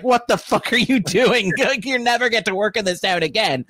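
high-pitched, incredulous mock studio boss; lightly hammed-up outrage with a laugh in it.

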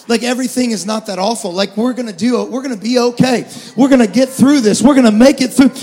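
building to a loud, ringing sermon cry; preaching at full force. Acted. Pastor rallying his church that life isn't awful, building to loud preaching.

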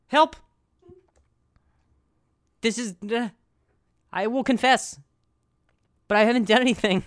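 high panicked pleading; frantic, desperate plea. Retold or imitated. Panicked plea while naked in lake.

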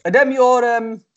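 high, loud attempt at Scouse; a hammed-up Scouse accent; Matt's Scouse voice. Imitating Matt on the canal run in mock Scouse. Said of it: "I'll do it in his voice".

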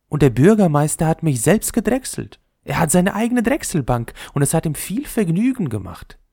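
the top courting the ball, showing off; proud spinning top. Acted. Top boasting of mayor.

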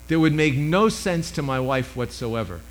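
usual speaking voice

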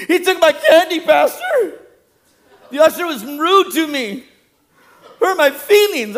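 put-on high, loud childish tattling; whining child. Child complaining to the pastor about rudeness, high whine. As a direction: wail it like a wronged little kid. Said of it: "It's the children do".

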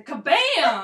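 spoken sound effects. Pretend.

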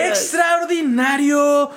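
high, slow, drawn-out shout; excited shout. Acted. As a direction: belt it out like a game-show host. Hosts topping each other's praise.

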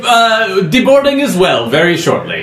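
bard voice. Acted. Bard NPC answers.

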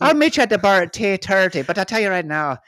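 high, loud Irish baboon; hammy Irish pal giving a warning; Irish accent. Pretend. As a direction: pitch it way up in a stage Irish accent. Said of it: "That's the Irish".

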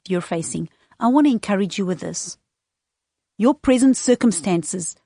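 ordinary talk, nothing marked

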